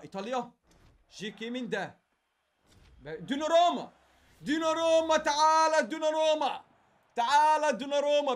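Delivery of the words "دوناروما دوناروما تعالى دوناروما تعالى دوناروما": louder calling a player's name; excited calling out. Real, not acted. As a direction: call the name out, stretched and hopeful. Calling the card in.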